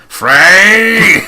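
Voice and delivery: high, loud barked name; a mock shout from a seventies cop show chief; angry police chief. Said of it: "cop show that the chief comes in".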